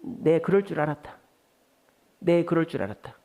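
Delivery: mild mock disdain; distrustful scoff. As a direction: sigh it out like you expected failure. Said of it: "불신하는 말의 대표적인 말이 뭘까요?".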